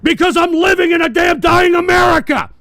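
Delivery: screaming his fury at the audience; furious ranting shout. Real, not acted. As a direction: yell it, shaking with rage. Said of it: "I'm so fucking pissed off".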